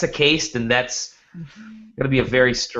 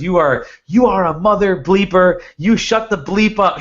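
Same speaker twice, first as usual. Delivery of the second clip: higher, louder retold outburst; imitated yelling; 6 semitones higher, 4 dB louder than usual. Retelling the scam caller's outburst at being called out, imitated yelling. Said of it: "He's yelling at me".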